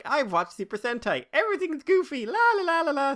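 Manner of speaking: put-on high, bright childish singsong; goofy kid voice. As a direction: play a giddy kid watching cartoons. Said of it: "if you're just sitting there like".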